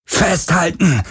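high-pitched yell; roared warning. A narrator's reading. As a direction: cry out to brace for impact. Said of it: "brüllte Galen".

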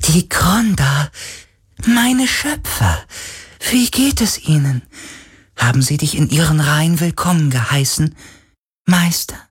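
a spirit longing for its makers; hopeful spirit. Acted. Spirit hopeful about creators.

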